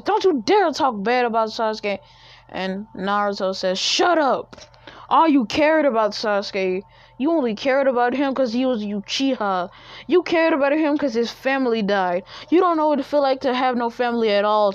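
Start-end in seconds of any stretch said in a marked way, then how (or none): 0.0-2.0 sharp, raised and louder; angry defense. Acted. Sakura defends Sasuke to Naruto. 3.8-14.7 high, loud furious yelling; angry shouting. Acted. Naruto yells at Sakura.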